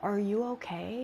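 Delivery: soft, hushed and gentle; a timid, whispered-soft check on a sad figure; quiet question. Retold or imitated. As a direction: ask it softly so nothing else hears. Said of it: "So she quietly asks".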